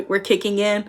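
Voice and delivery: ordinary talk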